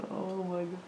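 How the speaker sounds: ordinary talk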